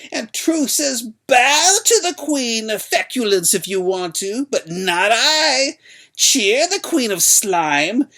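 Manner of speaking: high, loud, slow ranting hag; unquieted old crone. A narrator's reading. Old woman denouncing the queen to the crowd, high and loud. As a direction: rant on, refusing to be silenced. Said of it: "the old woman could not be quieted".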